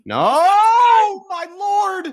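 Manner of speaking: very high, loud drawn-out scream; horrified scream. Real, not acted. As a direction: scream out in real horror. Horrified scream as goal threatens.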